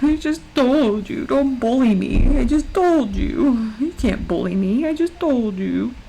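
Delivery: slow, drawn-out mock whine; mock whining. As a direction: drag each word out in playful protest. Mock whining at being bullied.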